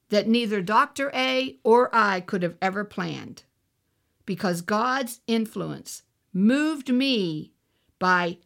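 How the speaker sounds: ordinary delivery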